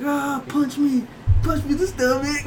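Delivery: high, strained angsty demand; mock teen anger. Angsty teen dares his friend to hit him, high mock anger. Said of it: "oh, all my anger".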